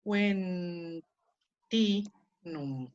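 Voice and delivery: "wen, ti, num" as separate beats; syllables split. Shown as a demonstration. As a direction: divide the word into beats. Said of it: "Entonces las divido a". ("wen, ti, num" separados)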